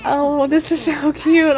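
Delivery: tearful and high, real emotion; welling up over the babies; on the edge of real tears; moved to tears. Real, not acted. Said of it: "I'm gonna cry".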